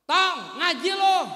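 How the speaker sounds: put-on loud slow call to the kid; mother calling out. Mother calling her boy to go recite Quran, loud slow call. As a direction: shout it across the house like a mom.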